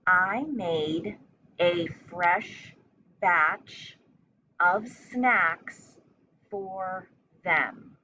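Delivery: chunked into short phrases with pauses between; teacher dictating a sentence; slow dictation. Shown as a demonstration. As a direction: dictate it piece by piece for young spellers. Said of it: "So just listen twice".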